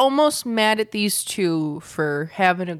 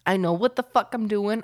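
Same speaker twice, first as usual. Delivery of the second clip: ordinary talk, nothing marked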